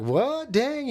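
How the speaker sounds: high-pitched mock astonishment; mock surprise. Girlfriend reacting to his complaint, feigned surprise. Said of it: "making all surprised".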